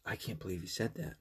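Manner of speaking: hushed, low mock disbelief; mock offended. Offended client to the speaker, hushed mock disbelief. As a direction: imitate a scandalized client under your breath.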